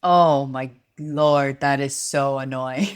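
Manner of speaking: slow, drawn-out exasperation; loud, stretched-out commiseration; exasperated sympathy. Real, not acted. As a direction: groan along in shared annoyance. Reacts to dumped rice.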